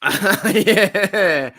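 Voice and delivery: "yeah" through a burst of laughter; laughing. Real, not acted. Streamer cracking up at his mate's joke, laughing.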